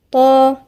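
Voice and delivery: طا with a thick emphatic ta; isolated heavy طا. Shown as a demonstration. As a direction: demo of the emphatic ط in طا. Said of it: "والطاء من الحروف المفخمة".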